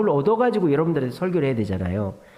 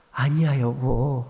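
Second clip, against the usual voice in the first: slow, lowered, placating murmur to wife; imitating a gentle husband; patient, unannoyed; 4 semitones lower, much slower than usual. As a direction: stretch it out soothingly, holding back irritation. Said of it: "짜증 안 내려고".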